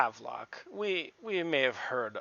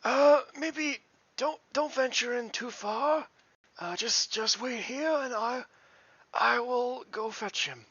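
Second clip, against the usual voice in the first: nervous NPC voice; 10 semitones higher than usual. Acted.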